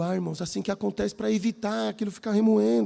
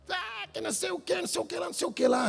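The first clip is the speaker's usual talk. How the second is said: mock whiny complaint; 9 semitones higher, 6 dB quieter than usual. Preacher mimics the young man's complaint.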